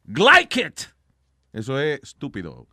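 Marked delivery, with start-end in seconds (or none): 0.0-0.8 mock Scottish. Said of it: "en Escocia, si usted le dicen".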